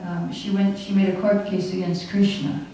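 ordinary talk, nothing marked

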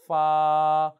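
loud 파 held level; long first tone. Shown as a demonstration. Teacher asking for long 일성, sustained level 파. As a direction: stretch 파 on one even pitch. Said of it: "일성 길게 하세요".

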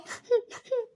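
high, broken sobs; short tearful sobs; crying. Acted. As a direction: break down in little sobs. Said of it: "आई, रडू नको तू".

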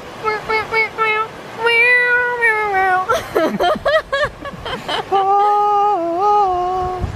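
high wah-wah riff dissolving into laughs; wailed riff and laughter. Real, not acted. Ending the singalong, high wails breaking into laughter. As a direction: wail the riff, then crack up.

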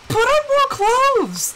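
very high, slow, drawn-out outcry; mock exasperated yell. Wife retelling retort to cold husband, mock exasperated yell. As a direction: wail it with exaggerated impatience. Retold quarrel over the heater.

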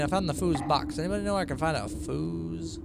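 ordinary talk, nothing marked

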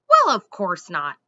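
loud, affronted squirrel denial; indignant squirrel. A narrator's reading. Squirrel asked if it burned trees, indignantly. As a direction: deny it hotly, punching the first word.

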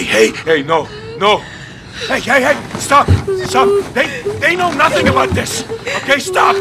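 rising, loud, frantic begging; panicked pleading. Acted. Prisoner begs soldiers not to hurt his family, panicked. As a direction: scream to stop them, voice climbing.

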